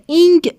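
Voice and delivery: اینگ with a firm final G; over-firm اینگ, the G fully sounded; hard, firm ING. Shown as a demonstration. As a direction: show the wrong way, اینگ closed on a hard G. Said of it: "تلفظ نمیشه به این صورت محکم".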